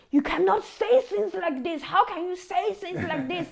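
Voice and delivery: high, loud, indignant outburst from the friend; angry friend. Retold or imitated. Retelling a friend's angry reaction to veganism, a loud high outburst. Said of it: "she got so upset and so angry".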